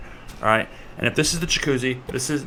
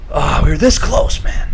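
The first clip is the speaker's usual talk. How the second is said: loud groan of near-miss frustration; hammed-up regret, loud; exasperated lament; 3 semitones higher, 7 dB louder than usual. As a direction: cry out at how near it was. Lamenting the missed goal.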